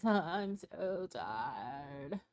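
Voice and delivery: very slow, stretched, moaning "so tired"; mock tired whine. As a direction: parody her exhausted drawl. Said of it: "in that voice".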